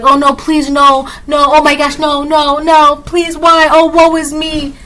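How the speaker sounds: high, hammed-up pleading wail; mock despair. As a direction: plead in exaggerated, self-pitying panic. Acting out intended panicked, self-pitying reaction.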